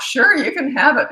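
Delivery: high, loud, over-eager yes; mock giving in. Parent giving in to kids on a flight, exaggeratedly agreeable.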